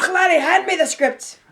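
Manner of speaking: high, loud mock Scottish accent; Billy Connolly. Co-host imagining the actor arriving at the reading, mock Scottish. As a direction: put on a jokey Scottish actor's voice. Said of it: "He probably showed up to do the reading and he'd be like".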